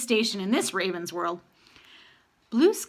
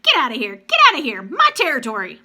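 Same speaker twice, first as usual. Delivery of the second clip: louder, harsh territorial calls; raven warning calls; 3 semitones higher, 8 dB louder than usual. A narrator's reading. Raven pair driving off a lone raven with calls. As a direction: chase the intruder off, raven style. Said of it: "three short quirks".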